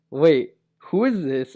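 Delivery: loud, slow startled question; alarmed. Retold or imitated. To the girl he just hugged, startled loud question. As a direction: blurt it out in alarm.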